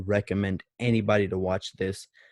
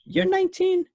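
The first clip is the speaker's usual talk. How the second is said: squeaky high mock mother; high-pitched mom voice; 4 dB louder than usual. Greg's mother surprised at Tommy's age, high squeak. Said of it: "She's like".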